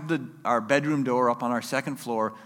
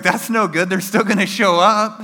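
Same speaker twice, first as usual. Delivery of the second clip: loud, higher protest; acted loud, higher reply; raised-voice objection; 8 semitones higher, 10 dB louder than usual. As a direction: call it out over the phone question.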